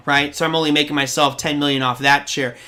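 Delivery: ordinary delivery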